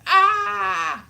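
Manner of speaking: loud, high cry of "ah"; mock exasperated scream. Learner faced with speaking Welsh, mock scream.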